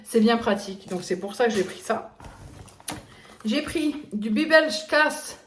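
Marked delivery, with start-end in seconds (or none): 3.4-5.4 slow, high mock Alsatian accent. Shown as a demonstration. Showing viewers an Alsatian cheese in a mock Alsatian accent. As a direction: drawl the cheese name in a put-on Alsatian accent. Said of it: "quand je fais l'accent".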